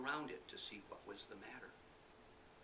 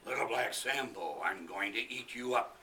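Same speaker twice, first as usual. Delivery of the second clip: loud menacing tiger voice; threatening tiger; 9 dB louder than usual. Acted. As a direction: loom over the boy as a hungry tiger.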